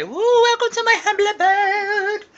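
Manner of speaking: very high, drawn-out mock hostess voice; playful grand welcome. Welcoming vlog viewers to the humble abode, playful high voice. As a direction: sing out a silly, slow high-pitched welcome. Vlog greeting in showy put-on voice.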